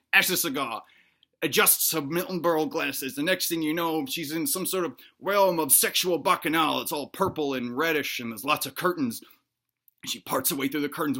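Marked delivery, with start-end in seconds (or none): none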